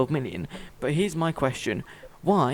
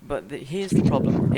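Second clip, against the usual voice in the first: ordinary talk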